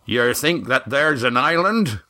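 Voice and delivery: demanding sea skipper voice; Roaring Jack demanding. A narrator's reading. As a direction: press the question like a skipper. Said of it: "Roaring Jack demanded".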